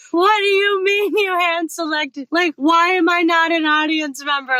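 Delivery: a squeaky, baffled objection; incredulous protest. Retold or imitated. Objecting to the judge about being handpicked, high incredulous protest. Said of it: "I was like".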